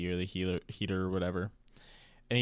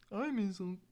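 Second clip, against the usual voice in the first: put-on much higher, softer kid-like line; mock childish quote; 12 semitones higher, 6 dB quieter than usual. Replaying the nephew mix-up as a high kid voice. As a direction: say it like a giddy child.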